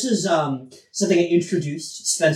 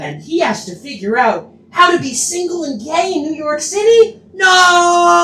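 rising hammed-up outrage, ending in a shout; mock dramatic trailer voice; 13 semitones higher, 6 dB louder than usual. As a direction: build it like a movie trailer, then yell No. Dramatic trailer-style buildup.

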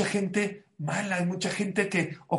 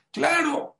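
smug certainty; 10 semitones higher, 4 dB louder than usual. Retold or imitated. Woman insists in retold dialogue.

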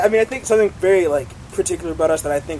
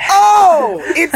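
flustered laugh; 12 semitones higher, 9 dB louder than usual. Retold or imitated. Startled fans stammering.

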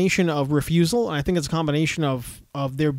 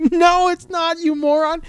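mock exasperated retort; 11 semitones higher, 4 dB louder, slower than usual. Retorting to anti-masker.

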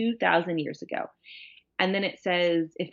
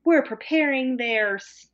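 put-on much higher, slow airy girlfriend voice; mock ditzy girlfriend; 8 semitones higher, slower than usual. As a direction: squeak it out like a clueless sweet girlfriend. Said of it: "the women are like".

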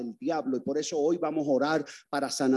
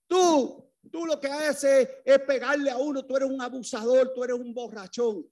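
retold child's yell; 9 semitones higher than usual. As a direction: hurl it back at him like an angry boy. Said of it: "yo le gritaba desde el otro cuarto".

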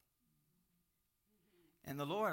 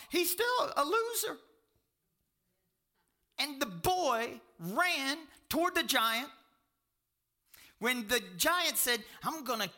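loud, high-pitched pulpit delivery; full-force preaching; 10 semitones higher, 8 dB louder than usual. Real, not acted. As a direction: preach it out loud to the whole room.

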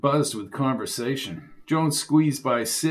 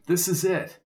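usual speaking voice

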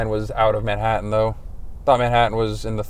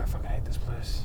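whispered grumble; 14 dB quieter than usual. Real, not acted. Stressed driver muttering.

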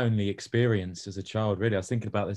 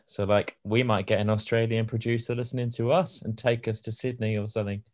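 usual speaking voice

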